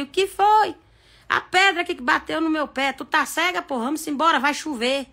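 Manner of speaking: brusque acted spousal exchange; impatient married couple. Husband scolds wife who stubbed her toe, impatient. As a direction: play a husband with no sympathy left. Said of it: "Um ano de casado".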